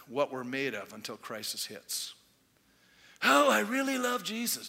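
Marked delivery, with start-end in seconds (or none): 3.2-4.7 high-pitched put-on gushing; mock devotion. Believer professing love before any crisis, mock devotion. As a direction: gush it as a hollow boast.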